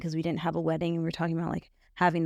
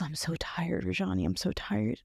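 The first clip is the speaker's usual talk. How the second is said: exhausted whisper; 4 dB quieter than usual. Retold or imitated. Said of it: "he goes".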